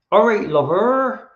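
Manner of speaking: put-on slow, high, loud West Country yokel accent; Hot Fuzz country accent. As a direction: ham up a drawn-out English bumpkin accent. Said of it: "that Hot Fuzz accent".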